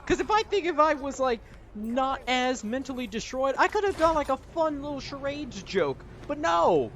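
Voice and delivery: pissed rant. Real, not acted. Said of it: "I'm pissed".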